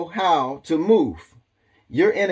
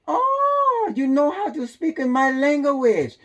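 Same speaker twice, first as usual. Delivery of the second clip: much higher, excited voice; mock delighted Korean host; 10 semitones higher, slower than usual. Korean restaurant owner impressed by a customer's hello, high and excited. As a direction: squeal it like the surprised delighted owner. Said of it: "They will".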